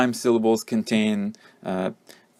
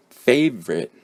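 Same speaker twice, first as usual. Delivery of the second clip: "favorite" as FAVE-rit, silent o; first-syllable stress; 3 semitones higher, 3 dB louder than usual. Shown as a demonstration. Teacher models word seven, first-syllable stress on "favorite". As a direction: stress the start of "favorite", swallow the middle vowel. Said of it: "you have the first syllable being stressed".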